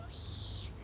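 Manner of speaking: breathy whispered plea. Retold or imitated. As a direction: whisper it so nobody hears. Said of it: "said in a voice that no one can hear".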